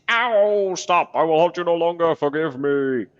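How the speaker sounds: loud, high pained pleading; begging corpse. Acted. The corpse begging Marta to stop, loud and high. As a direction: cry out under the blows.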